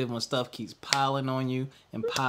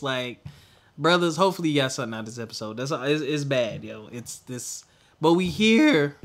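ordinary talk

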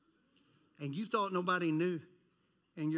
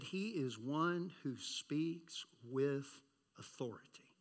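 slow, softer whispered words; reverent whisper; 6 semitones lower, 8 dB quieter, slower than usual. Acted. Preacher recalling the crowds' praise, slow reverent whisper. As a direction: hush it like a secret. Repeating what people said.

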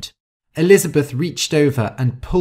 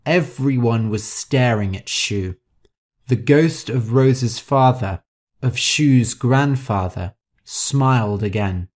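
plain reading voice

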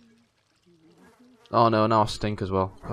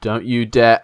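loud warning; 9 dB louder, much slower than usual. Real, not acted. Warning friend louder.

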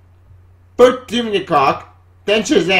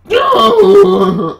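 exasperated laugh-cry; 14 semitones higher, 8 dB louder than usual. Real, not acted. Exasperated outburst.

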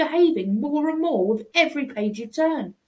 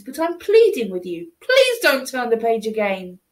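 plain reading voice

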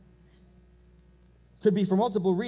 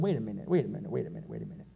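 much lower, fading mutter; hushed double take; 11 semitones lower, 7 dB quieter, much faster than usual. Retold or imitated. Preacher puzzling over the verse he read, muttering low. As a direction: repeat it under your breath, sinking lower each time.

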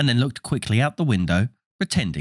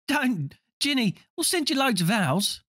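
put-on young boy voice; 13 semitones higher, 4 dB quieter than usual. Twin comforts crying sister.

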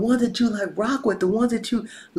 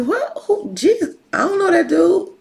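high, loud mock bafflement; hammed-up incredulous squawk; fronting denial; 9 semitones higher, 7 dB louder than usual. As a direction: act like you never heard of him. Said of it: "Like, he was fronting".

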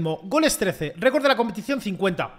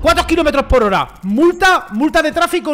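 excited; 7 semitones higher, 7 dB louder than usual. Real, not acted. Excited about shot speed.

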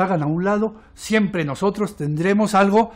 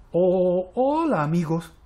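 very slow, hesitant, drawn-out request; timid; much slower than usual. A narrator's reading. Little blot asking shapes to let her play, timid. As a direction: stretch the words out shyly, testing the welcome. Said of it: "se acerca tímidamente y le dice".

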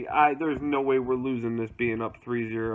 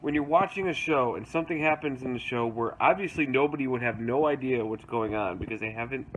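usual speaking voice

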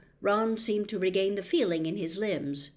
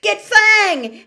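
higher, loud urgent yell; boy yelling over the chaos; 12 semitones higher, 14 dB louder than usual. Acted. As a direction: shout the order to grab the dog. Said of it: "Harry yelled".

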